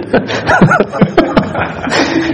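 real high, wheezy laugh; amused laugh. Real, not acted. Preacher joking about his dad's question, amused laugh. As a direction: laugh out at your own joke.

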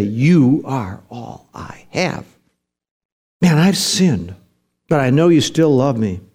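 low, hushed prayer of surrender; acted plea, drawn out and subdued; crying out desperately. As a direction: confess it slowly and humbly to God. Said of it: "where we cry out and we say".

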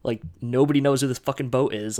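usual speaking voice

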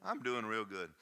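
ordinary delivery

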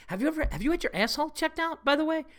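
high, fast mock-concerned ask; deadpan concern, pitched high; dead serious question. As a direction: lean in and ask it in total earnest. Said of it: "dead serious".

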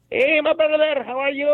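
high-pitched imitation of an overfriendly greeter; mock friendly greeter. Mimics men greeting him.

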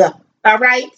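usual speaking voice

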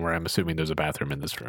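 rapid low mutter; quick aside. Real, not acted. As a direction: rush the aside.

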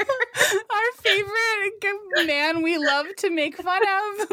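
giggling through the words, pitch jumping high; can barely get it out for laughing. Real, not acted. Podcast host laughing about the character they mock, breaking into giggles.

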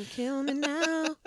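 high, drawn-out mock wail; high slow whine.

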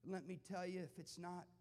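fervent sermon climax; full-force preaching. Acted. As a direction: drive the point home to the crowd.

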